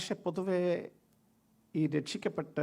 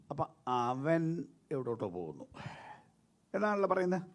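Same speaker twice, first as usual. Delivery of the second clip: ordinary talk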